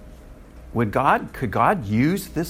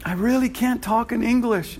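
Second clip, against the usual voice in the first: higher mock whine; imitating a student; 9 semitones higher than usual. Preacher mimicking his preaching students, higher. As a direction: play a timid student making an excuse. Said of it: "students in my preaching class say".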